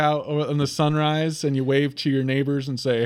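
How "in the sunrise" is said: normal talking voice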